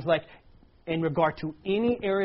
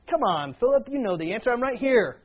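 higher, louder, gently chiding appeal; mild exasperation; 7 semitones higher, 5 dB louder than usual. Retold or imitated. Jesus answering Philip's question, mildly exasperated. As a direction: urge a friend to see the obvious.

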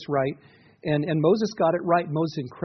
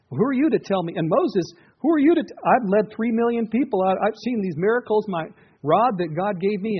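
higher, louder mock-offended retort; indignant Moses; 6 semitones higher, 5 dB louder than usual. Pastor acting out a defensive Moses. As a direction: play Moses bristling at the advice.

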